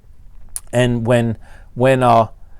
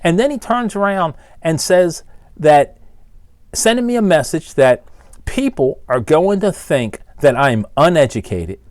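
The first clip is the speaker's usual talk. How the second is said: usual speaking voice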